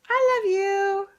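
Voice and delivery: high sing-song coo. Real, not acted. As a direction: croon it to a pet. Cooing to the dogs.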